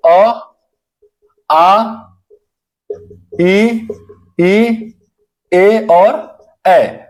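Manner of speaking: अ, आ, ई, ई, ए और ए each held out slowly with lips unrounded; vowel demo. Shown as a demonstration. Teacher to students, showing unrounded lips: slow, drawn-out अ, आ, ई, ई, ए और ए. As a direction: sound out अ, आ, ई, ई, ए और ए one by one, drawing out the last ए. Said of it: "होठों की जो आकृति है वो गोलाकार नहीं".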